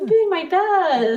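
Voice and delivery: high, slow, whiny self-defence; drawn-out high playful whine; mock plea. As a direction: plead pitifully, hamming it up. Playful plea after being teased.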